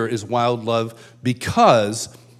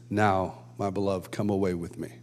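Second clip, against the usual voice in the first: softer, gentle lightly voiced Lord; tender invitation; 5 semitones lower, 6 dB quieter than usual. Jesus kneeling, arm out to the speaker, tender. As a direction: invite lovingly, a touch hushed. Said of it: "He says".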